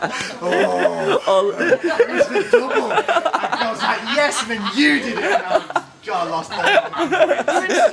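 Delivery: high, laughing through the words; cracking up while telling it. Real, not acted. Laughing at the night's video.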